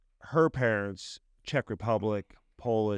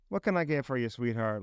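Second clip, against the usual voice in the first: put-on sweet diner waitress in a light Southern accent; Southern Belle. Host imitates how a Southern Belle talks, in a light Southern accent. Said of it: "the Southern Belle, how they talk?".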